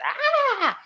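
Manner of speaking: hammed-up film scream; mock scream. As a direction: let out a movie-star shriek. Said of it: "she was".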